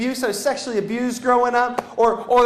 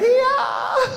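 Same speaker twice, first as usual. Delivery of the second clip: high-pitched comic yeah; mock agreement; 11 semitones higher than usual. Nervous jumper told not to worry, high mock agreement. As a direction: squeak out a doubtful agreement, hammed-up. Feigned relief about missing plane door.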